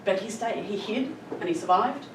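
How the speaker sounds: ordinary talk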